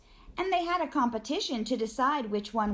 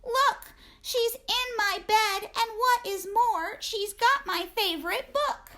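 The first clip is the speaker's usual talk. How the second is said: high squeaky little bear voice; Baby Bear; 11 semitones higher than usual. Acted. Baby bear tattling on Goldilocks in a high voice. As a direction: play the small bear telling on Goldilocks. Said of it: "Baby Bear said".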